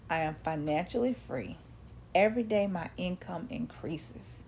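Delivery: soft, half-whispered declarations; quiet, believing mirror affirmations; affirmation recital. Shown as a demonstration. Reciting her own affirmations.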